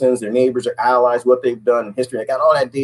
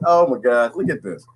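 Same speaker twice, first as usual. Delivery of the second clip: ordinary talk, nothing marked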